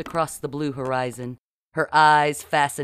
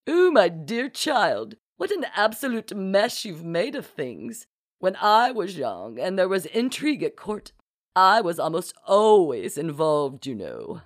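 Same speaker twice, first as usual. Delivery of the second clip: reminiscing duchess; 4 semitones higher than usual. A narrator's reading. Duchess recalling court intrigue to a dear child, grandly reminiscing. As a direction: sweep up and down grandly, lingering on words. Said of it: "the Duchess reminisced".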